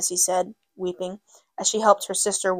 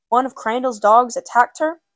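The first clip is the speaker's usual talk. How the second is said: loud, high angry question; alarmed; 4 semitones higher, 6 dB louder than usual. A narrator's reading. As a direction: demand it in shock. Brother alarmed at the news.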